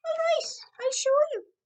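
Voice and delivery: croaking. Acted. Leprechaun giving in to Dan, croaking high and loud. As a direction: croak it out, defeated. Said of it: "croaked the leprechaun".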